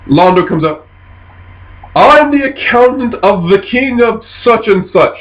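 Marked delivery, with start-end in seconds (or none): 2.0-5.2 lightly voiced official, pitched up; bluffing accountant. Londo introduces himself to boarding pirates, bluffing. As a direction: announce yourself higher, as if bluffing.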